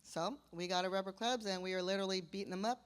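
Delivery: usual speaking voice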